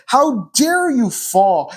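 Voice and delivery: mock furious parent yelling at a toddler who fell; pretend angry yell. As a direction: bark it down at a fallen child like a harsh parent. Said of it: "I yelled at her and I said".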